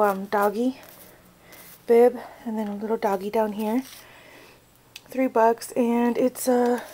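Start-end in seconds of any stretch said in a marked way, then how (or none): none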